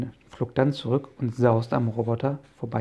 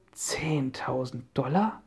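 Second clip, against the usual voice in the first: slow, whispered disbelief on "Zehntausend Dollar"; disbelieving whisper; 4 dB quieter, slower than usual. Acted. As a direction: breathe "Zehntausend Dollar?" slowly as if you can't believe it. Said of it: "wiederholte Onkel Titus ungläubig".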